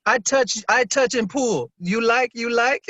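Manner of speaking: hammy fake Chinese accent, slow; clumsy put-on Chinese accent; bad Chinese accent. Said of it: "worst Chinese accent ever".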